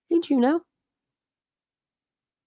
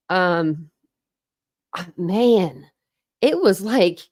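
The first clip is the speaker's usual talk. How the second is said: ordinary delivery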